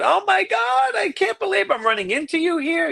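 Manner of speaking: high, excited gushing woman; mock gushing rich lady; gushing fan. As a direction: squeal it like a starstruck fan. Excited wealthy woman quoted.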